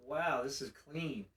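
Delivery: soft, slow, low mock wonder; acting quietly impressed; hushed awe. Newcomer looks round the Institute, hushed awe. Walking around awed by clean place.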